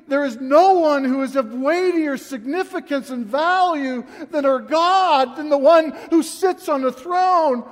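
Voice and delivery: loud, high, full-force sermon delivery; impassioned preaching. Real, not acted. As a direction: cry out the sermon with real, swelling emotion.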